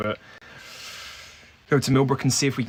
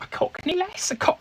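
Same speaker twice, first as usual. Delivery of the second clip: high, light Cockney voice for the lass; lightly put-on Cockney girl. Player tries out the witness voice, a high Cockney lass. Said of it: "Should we go with a".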